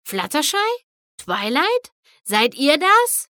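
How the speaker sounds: anxious calling. A narrator's reading. Rainbow calls out for friends.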